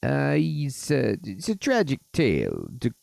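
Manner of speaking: put-on high, halting Mario-style Italian accent. Fairy godfather tells of the queen in a Mario accent. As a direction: grope for words in cartoon Italian. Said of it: "got the, the Mario accent".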